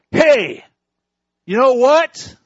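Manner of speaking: high, loud parrot squawk; cheeky parrot. Acted. Parrot taunting the shopping couple, high loud squawk.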